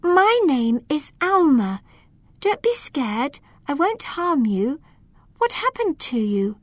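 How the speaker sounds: high, gentle and kind. A narrator's reading. Girl reassures a trapped elf, kind high voice. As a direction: speak kindly, pitched well up. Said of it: "said to him in a kind voice".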